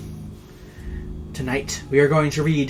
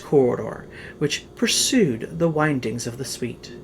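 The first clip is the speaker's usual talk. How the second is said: louder, theatrical reading of a gothic tale; dramatic story narration; 3 semitones higher, 4 dB louder than usual. A narrator's reading. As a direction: tell it like a ghost story by candlelight. Reads the Poe tale aloud.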